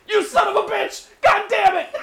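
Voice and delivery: high, loud comic shouting; bellowing at a dry turkey; mock rage. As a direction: blow up in hammed-up fury. Acting Homer's overdramatic rage.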